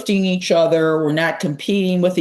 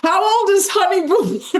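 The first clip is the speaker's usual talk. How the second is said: much higher goofy squeal; silly high voice; 12 semitones higher than usual. Pretend. Pleading with listeners for help, high goofy squeal. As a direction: wail the question in a cartoonish high pitch. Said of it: "Now we're just getting overly silly".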